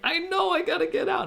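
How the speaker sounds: high, loud frantic cry; panicked yell. Retold or imitated. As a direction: shriek it back in panic. Panicked reply to friend.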